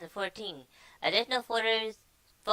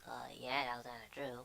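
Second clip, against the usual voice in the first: quiet aside; 7 semitones lower, 15 dB quieter than usual. Real, not acted. Muttered aside after quote.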